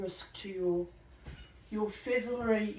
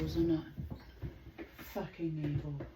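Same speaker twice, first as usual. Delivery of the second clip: hushed, softer muttered comment; whispered aside; 4 semitones lower, 6 dB quieter, much slower than usual. Real, not acted. Reader's hushed aside on the story's cruelty. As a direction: drop to a confiding whisper.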